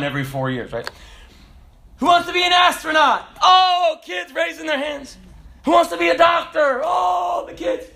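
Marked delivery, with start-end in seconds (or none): 2.0-3.3 high, loud, eager question; teacher calling out. Acted. Teacher polling kids on career day, loud and high. 3.4-5.2 mimicked eager kids. Kids raising hands on career day, high mock excitement. 5.6-6.8 high, loud, eager question; teacher calling out. Acted. Teacher polling kids on career day, loud and high. 6.8-7.8 high-pitched excited "oh"; mimicked eager kids. Kids wanting to be doctors, high mock excitement.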